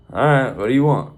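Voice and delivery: put-on loud, gruff old farmer bark. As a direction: snap it at the stranger like a grumpy farmer. Gruff farmer greets stranger.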